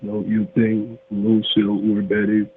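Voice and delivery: ordinary talk, nothing marked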